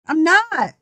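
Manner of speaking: ordinary talk, nothing marked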